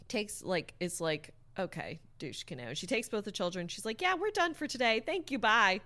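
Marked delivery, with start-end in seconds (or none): 1.6-2.7 muttered insult. Acted. Dismissing instructor. 4.0-5.8 curt high brush-off. Acted. Curt exit from instructor.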